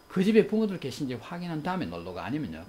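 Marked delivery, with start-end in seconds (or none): none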